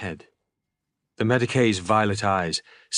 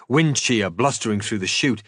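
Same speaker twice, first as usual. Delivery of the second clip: ordinary delivery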